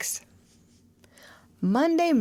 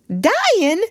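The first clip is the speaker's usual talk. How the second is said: high, alarmed question; shocked aunt; startled; 12 semitones higher, 4 dB louder than usual. Acted. Aunt Polly hearing Tom is dying, startled.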